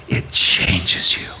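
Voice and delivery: hushed whisper, slowed down. Acted. Preacher drives home the point to the congregation, whispered. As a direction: breathe It changes you almost silently. Preacher repeats key line.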